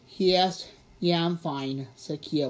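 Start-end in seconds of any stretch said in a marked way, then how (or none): none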